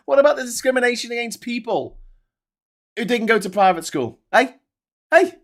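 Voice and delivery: ordinary delivery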